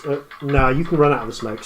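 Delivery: normal talking voice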